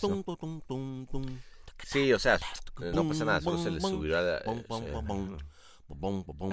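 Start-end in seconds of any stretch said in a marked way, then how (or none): 1.8-6.5 running out of air mid-sentence; out of breath, words trailing off. Real, not acted. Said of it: "ay, me quedé sin aire".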